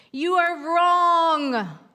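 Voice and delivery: the island argues back, loud and slow; arguing. Acted. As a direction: plant your feet and contradict. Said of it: "argued the island".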